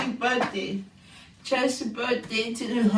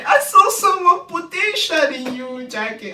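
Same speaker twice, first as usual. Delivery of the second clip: high, loud mock earnest; mocking Marsha; 5 semitones higher, 6 dB louder than usual. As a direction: parody his earnest line. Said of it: "I'm just using Marsha word".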